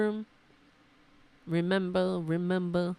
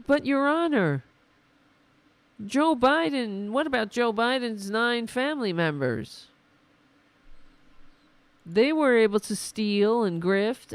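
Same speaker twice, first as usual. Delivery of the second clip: higher pleading voice mocking a defendant; mocking whiny defendant; 5 semitones higher than usual. Mocking a defendant pleading to the judge, whiny and high. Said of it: "you go to court and you say".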